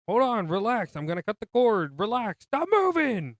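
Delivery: loud, urgent, trying to calm him; urgent calming shout. Retold or imitated. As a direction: shout to steady a panicking son. Father calming son on boat.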